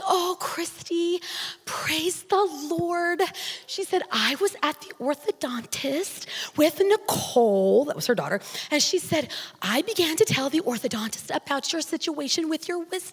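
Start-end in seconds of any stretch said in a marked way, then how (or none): 0.0-3.2 high, soft and very slow; tiny sweet lady voice. Retold or imitated. Preacher imitating her sweet boss calling her in. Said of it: "she just talked like this". 4.1-7.8 soft sweet lady. Retold or imitated. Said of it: "she just talked like this". 8.7-13.1 soft sweet lady. Retold or imitated. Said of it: "she just talked like this".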